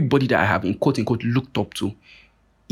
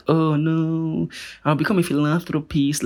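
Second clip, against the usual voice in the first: mock pious voice; 5 semitones higher, slower than usual. Mocking a sanctimonious do-gooder.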